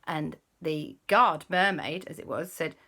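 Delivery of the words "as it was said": usual speaking voice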